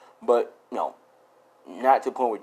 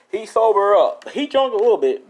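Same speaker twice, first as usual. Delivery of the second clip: higher, louder, laughing repeat of "He's sober up"; 9 semitones higher, 8 dB louder than usual. Real, not acted.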